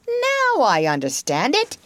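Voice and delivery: high, swooping moment of understanding; excited penny-drop; delighted realization. Acted. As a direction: seize on the idea eagerly. Toad grasps the secret plan.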